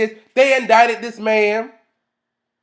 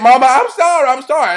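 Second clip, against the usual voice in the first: higher, louder mock apology; pleading apology; 5 semitones higher, 6 dB louder than usual. Daughter apologising to her mama, pleading. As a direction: plead like a child caught out.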